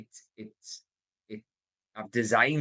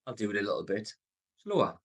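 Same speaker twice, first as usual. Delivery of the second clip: slowed down with pauses before "slower"; deliberately slower; faster than usual. Shown as a demonstration. Sales coach showing listeners how he slows his voice, deliberately slower. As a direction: draw it out a little, pausing before "slower". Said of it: "I'll speed up my voice sometimes".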